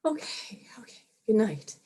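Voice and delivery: unvoiced whisper, trailing off in defeat; a tired mom whispering good night to her daughter.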